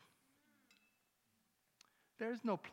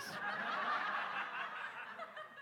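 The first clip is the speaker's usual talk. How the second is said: high breathy burst of laughter; real high laugh. Real, not acted. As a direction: crack up at the memory. Preacher laughs.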